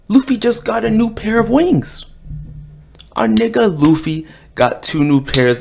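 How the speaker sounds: loud, high and hyped; excited shout. Real, not acted. Vlogger hyping Luffy's new wings to viewers, loud and excited. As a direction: shout the big news like it's huge.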